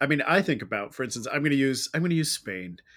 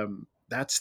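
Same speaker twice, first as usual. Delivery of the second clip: ordinary delivery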